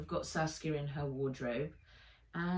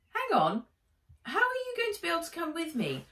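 high, fast doubtful question; quick worried question; Nat's worried voice; 10 semitones higher, faster than usual. Acted. Nat questioning Henry.

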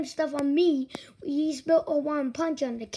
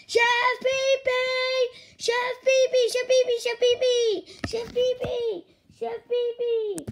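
put-on high kid calling the chef awake; Junior calling; 7 semitones higher than usual. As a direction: chant the name to wake him.

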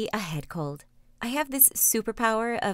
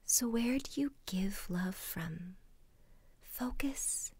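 guided meditation whisper; 9 dB quieter than usual. Acted. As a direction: whisper them gently into calm.

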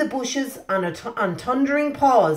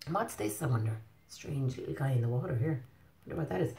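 hushed wonder; 7 semitones lower, 12 dB quieter, faster than usual. Real, not acted. Hushed wondering over picture.

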